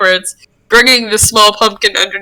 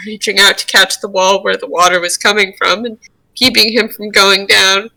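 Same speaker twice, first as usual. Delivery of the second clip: normal talking voice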